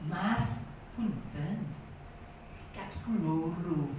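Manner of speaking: put-on soft, slow old woman's voice hiding anger; mild, quiet Javanese grandma: "Mas, punten. Kakiku loro."; grandma's polite complaint. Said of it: "Si Mbah Putri ngomongnya piye?". (nenek Jawa menegur dengan lembut menahan marah)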